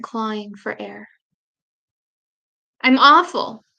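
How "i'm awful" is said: choked, louder sobbing words; crying with a contorted face; garbled crying. Retold or imitated. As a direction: force it out through tears. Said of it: "My words garble".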